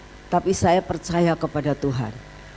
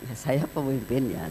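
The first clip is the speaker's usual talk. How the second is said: calm and smiling; 4 semitones lower, 7 dB quieter than usual. Retold or imitated. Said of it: "nggak pakai garang ya, saya terus senyum aja".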